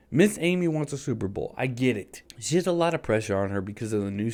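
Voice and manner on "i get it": ordinary talk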